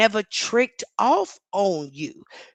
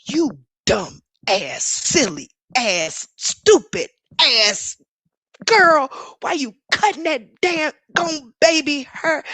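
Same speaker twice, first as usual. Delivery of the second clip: mock angry yelling at the screen; 6 semitones higher, 5 dB louder than usual. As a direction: voice climbs high and loud, insult after insult. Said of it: "I was just like".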